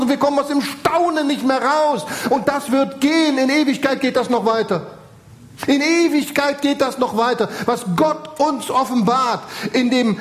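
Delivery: impassioned preacher in full flow; fervent preaching. Real, not acted. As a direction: preach it high, stretching the key words. Preaches with rising fervour.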